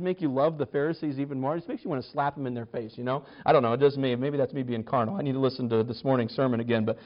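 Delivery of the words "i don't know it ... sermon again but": ordinary talk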